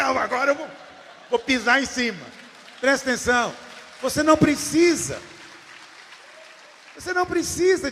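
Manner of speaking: loud, cocky mock confidence; emboldened. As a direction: act out getting bold, at full volume. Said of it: "Aí eu fiquei ousado".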